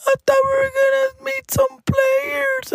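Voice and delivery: mock kid voice of Nicolas; whiny kid voice. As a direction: imitate a disappointed child whining. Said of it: "Nicolas was like".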